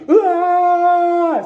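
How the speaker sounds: high pretend cry of terror; a short staged scream; mock crash scream. Preacher acting a crash victim's scream.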